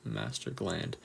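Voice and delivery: ordinary talk, nothing marked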